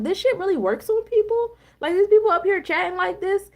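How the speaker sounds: high, disbelieving question; incredulous. Retold or imitated. Reacting to the inauguration news coverage, disbelieving. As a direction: act stunned that it works on anyone. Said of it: "And I'm like".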